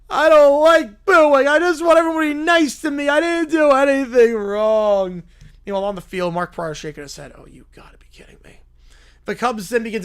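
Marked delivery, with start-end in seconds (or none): 0.1-5.2 put-on whiny crying kid. Fan whining to the booing crowd. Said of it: "still crying". 7.3-8.6 whispered under the breath, fast; disgusted whisper. Acted. Pitcher whispering in disgust on the mound. Player muttering in disbelief.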